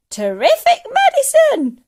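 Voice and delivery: put-on high screechy old woman's yell; full-force shrieking praise; exultant cry. A narrator's reading. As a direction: shout it with wild delight. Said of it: "she cried".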